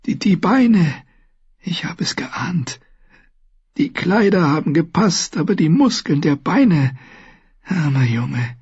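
high, breath failing into whispers; weak, dying voice. A narrator's reading. Wounded maester talking to Bran about his legs, feeble and halting. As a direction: force words out with little strength.

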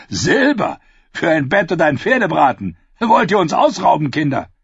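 incredulous, mocking the silver demand; a knight mocking children's price, incredulous; scoffing knight. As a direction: scoff like a knight being overcharged. Sir Hyle scoffs at price.

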